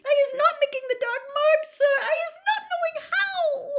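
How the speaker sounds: stammering, then loud, very high squealing; squeaky little elf in tearful panic; Winky. A narrator's reading. Sobbing house-elf protests innocence to the wizard, stammered squeal. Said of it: "squealed Winky".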